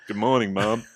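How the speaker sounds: loud, hammed-up actor impression; Morgan Freeman. Child's device greeting Mum, mock screen-narrator voice. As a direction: greet grandly as a film narrator. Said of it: "I'd have him as Morgan Freeman".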